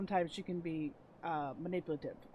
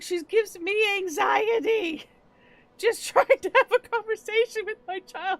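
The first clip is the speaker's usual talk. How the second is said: exasperated complaint; 13 semitones higher, 10 dB louder than usual. Retold or imitated. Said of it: "She's like".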